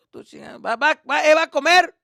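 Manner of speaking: loud high father; dad yelling. Retold or imitated. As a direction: order him to eat.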